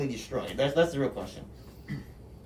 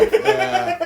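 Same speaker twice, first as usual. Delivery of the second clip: high laughing pleas; 14 semitones higher, slower than usual. Real, not acted. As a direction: plead while laughing. Laughing hostage plea.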